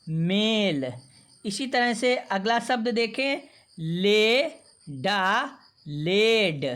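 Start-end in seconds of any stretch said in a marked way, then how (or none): 0.1-0.9 "mel" drawn out, falling; blended word "mel". Shown as a demonstration. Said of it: "इसका उच्चारण करेंगे". 3.8-6.7 "le", "da", then "led", very slow; stretched syllables blended into "led"; sounding out "led". Shown as a demonstration. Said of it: "बारी बारी से पढ़ने का अभ्यास करेंगे".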